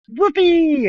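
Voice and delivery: high, loud rodeo whoop; whoopee yell. Retold or imitated. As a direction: whoop like riding a horse. Jesus riding the man like horse.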